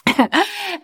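short high giggle after the joke; high laugh. Real, not acted. After promising a review of the toy, real giggle. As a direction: laugh at yourself.

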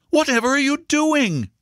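high-pitched alarmed question; alarmed cry. A narrator's reading. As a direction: cry it out as a startled mother. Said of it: "she cried".

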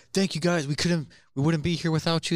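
mock band frontman. Mocking bands on stage, high gushing thanks.

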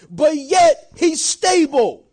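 slow, loud, punched out; preached shout. Real, not acted. Preacher proclaiming to the congregation, slow shouted emphasis. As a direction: hammer it home to the church. Preacher driving home his point.

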